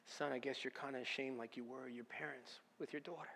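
soft, hushed voice of the Holy Spirit speaking to a son; a quiet fatherly nudge from God; gentle voice of God. Retold or imitated. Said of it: "the Holy Spirit said to me".

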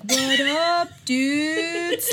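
chill surfer greeting; lazy, laid-back surfer dude; a hint of a stoner surfer. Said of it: "talk like a surfer".

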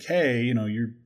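ordinary talk

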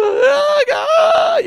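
very high, loud sobbing wail; mimicked wailing cry. Imitating people running to the altars, loud tearful wail. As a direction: imitate crying out in anguish at the altar.

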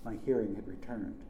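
plain reading voice

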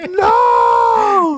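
high, loud disbelieving yell; shocked shout. Retold or imitated. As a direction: squeal of disbelief. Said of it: "And I was like".

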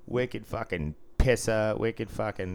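put-on slow, drawn-out Boston accent. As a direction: stretch the slang in broad Boston. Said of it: "Boston used to be".

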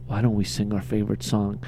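low, soft creepy charm; creepy soft invitation. Retold or imitated. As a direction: murmur it like a sleazy creep. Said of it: "he's like".

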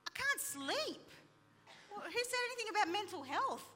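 high-pitched indignant retort; desperate protest. Retold or imitated. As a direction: protest with the voice shooting up. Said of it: "I was desperate".

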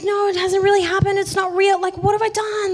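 high, loud mock panic. Retelling her panic over the forgotten sins part, loud high panic. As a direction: spiral into imitated alarm. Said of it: "don't panic".

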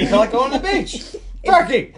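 high, loud laughing turkey; real laughter. Real, not acted. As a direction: crack up on the punchline. Laughing at the running joke.

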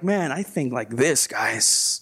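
low, soft smug boast; mock smug. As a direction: play the know-it-all.

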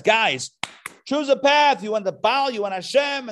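loud, high-pitched rally to a crowd; rousing challenge. Acted. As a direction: call them out to make a choice. Acting Elijah challenging the crowd.

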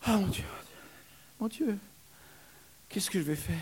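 high whimpering cry. Acted. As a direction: whine helplessly to God. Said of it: "la pauvre femme geignait".

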